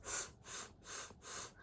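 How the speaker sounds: "s" as a whispered slushy lateral hiss; whispered faulty hiss; lisped s. Shown as a demonstration. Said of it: "some people send off s".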